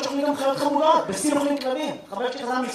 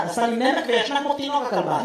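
ordinary talk

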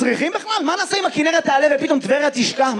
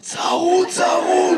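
hoarse, higher strained reply; 5 semitones higher, much slower than usual. Real, not acted. Audience member explaining his odd voice to the comedian, hoarse. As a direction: force the word out with a hoarse voice. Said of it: "למה אתה נשמע ככה".

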